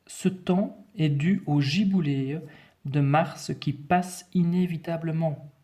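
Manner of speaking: slow, measured dictation voice; dictation reading. Shown as a demonstration. Teacher reading the text to pupils, slow careful dictation. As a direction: read it out slowly, phrase by phrase.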